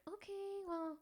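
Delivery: mock high-pitched self. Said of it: "I'm like".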